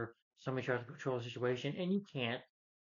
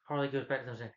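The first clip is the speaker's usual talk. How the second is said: normal talking voice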